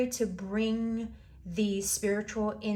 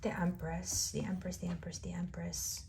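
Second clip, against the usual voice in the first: fading to a whisper; 3 semitones lower, 7 dB quieter than usual. Real, not acted. Tarot reader savouring the card she drew for Leo, fading to a whisper. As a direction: repeat the name softer each time until it is a whisper.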